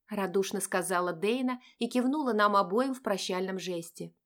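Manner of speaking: ordinary talk, nothing marked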